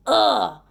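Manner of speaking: loud, exasperated "ugh"; disgusted groan. Real, not acted. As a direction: burst out with disgust at a bug. Disgust at second stink bug.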